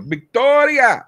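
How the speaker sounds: imitated Cuban dad bellowing, high and drawn out; thick Cuban accent. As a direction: holler the name slowly in a thick Cuban accent. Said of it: "he, thick Cuban accent".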